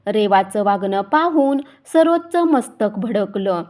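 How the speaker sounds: ordinary delivery, nothing marked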